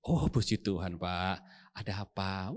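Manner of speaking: low, soft and slow gentle answer; meek pastor reply. Retold or imitated. Pastor calmly greeting the angry neighbour, soft and slow. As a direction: answer sweetly like a saintly pastor.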